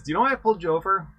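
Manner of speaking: high, fast cop's question; a loud, quick traffic cop; voicing the cop. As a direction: rattle it off like a cop at the window. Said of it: "He says".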